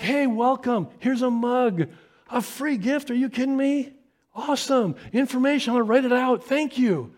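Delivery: very high, breathless gush; overjoyed hub greeter. Retold or imitated. Hub volunteer greeting the family, gushing and very high. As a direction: gush over every little thing. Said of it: "they're like".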